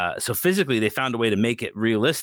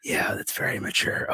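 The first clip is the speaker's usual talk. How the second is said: whispering; 4 dB quieter than usual. Retold or imitated. Quoting himself under his breath.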